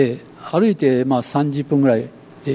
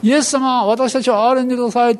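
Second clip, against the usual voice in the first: raised, higher plea for mercy; crying out together; 7 semitones higher than usual. Retold or imitated. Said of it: "とみんなが叫んでいる時".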